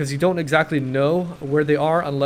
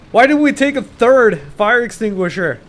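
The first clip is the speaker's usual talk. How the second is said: exasperated outburst; 8 semitones higher, 7 dB louder than usual. Real, not acted. Frustrated at running out.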